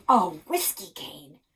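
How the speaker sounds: slow, loud, thrilled exclaim; dad thrilled by the secret cane; excited. Acted. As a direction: savour the discovery out loud. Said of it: "Dad nearly bounced off his chair".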